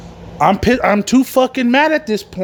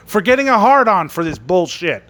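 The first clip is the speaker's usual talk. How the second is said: ordinary talk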